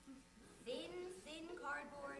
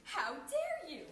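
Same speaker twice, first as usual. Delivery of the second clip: mock outrage; 3 semitones lower, 7 dB louder than usual. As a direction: scold it slowly in affronted disbelief. Acted outrage at automatic toilet.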